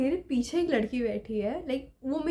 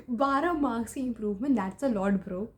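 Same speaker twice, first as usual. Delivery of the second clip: ordinary delivery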